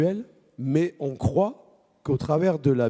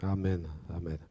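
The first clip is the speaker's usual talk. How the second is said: quiet prayer; 6 semitones higher, 14 dB quieter than usual. Real, not acted. Leader praying quietly for the morning. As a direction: pray softly, head bowed.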